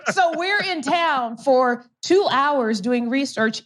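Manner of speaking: laughing. Real, not acted. Guest recounting the lucky day to hosts, laughing. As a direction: laugh the words out in amused disbelief. Laughing while recalling the coincidence.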